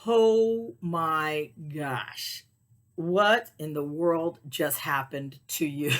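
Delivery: very slow, drawn-out astonishment; stunned disbelief. Retold or imitated. Owner taking stock after the chaos, slow stunned disbelief. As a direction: stretch each word in dazed wonder.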